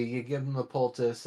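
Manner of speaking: ordinary talk, nothing marked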